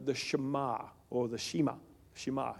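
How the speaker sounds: usual speaking voice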